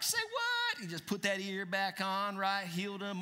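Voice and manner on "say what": much higher, mock astonished squeak; a jokey startled "say what"; surprised. Preacher voices Jesus reacting to the cut-off ear, surprised. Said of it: "And Jesus is like".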